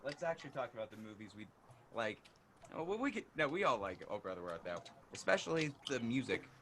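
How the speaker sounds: faint, far-off audio; barely audible. Real, not acted. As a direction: sound distant and faint.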